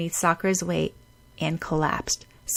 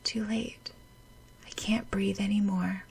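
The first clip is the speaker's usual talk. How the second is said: very soft, breathless whisper; fading whisper; 9 dB quieter than usual. Acted. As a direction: let the voice die away. Passing out.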